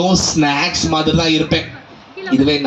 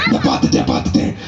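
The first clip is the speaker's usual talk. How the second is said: deep lion growl. Acted. Lion spots the goat.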